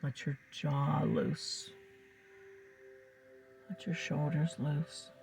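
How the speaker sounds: calm, soothing hypnotic guidance; hypnotist. Acted. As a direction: lull the listener into trance. Leads a hypnosis session over music.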